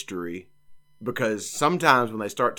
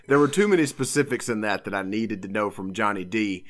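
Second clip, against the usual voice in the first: usual speaking voice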